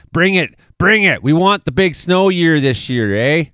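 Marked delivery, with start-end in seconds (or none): 0.1-0.6 eager. Real, not acted. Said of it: "I would love it". 0.8-1.2 eager. Real, not acted. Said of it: "I would love it".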